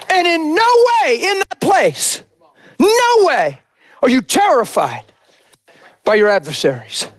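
full-force sermon cadence, rising and shouting; preaching. Acted. Pastor preaching hard to his church. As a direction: preach it out to the congregation.

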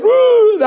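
loud high imitated wail; high mock-bawling cry. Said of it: "I'm bawling".

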